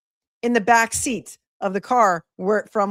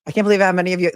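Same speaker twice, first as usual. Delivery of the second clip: ordinary talk, nothing marked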